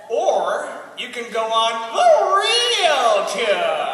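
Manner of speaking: very slow, stretched Southern drawl; milk the punchline with a long swooping drawl; exaggerated drawn-out Southern delivery; Jim Spencer, punchline. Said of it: "and said".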